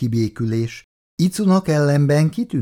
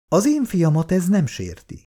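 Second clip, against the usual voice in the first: proud retort; 8 semitones higher than usual. A narrator's reading. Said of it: "vágta ki büszkén".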